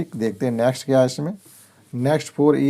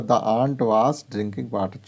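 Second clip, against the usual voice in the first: reads the English sentence very slowly, word by word; slow clear reading; much slower than usual. Shown as a demonstration. Teacher reading an example sentence to pupils, very slow. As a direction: spell it out slowly for the class. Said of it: "जैसे ये लिखा हुआ".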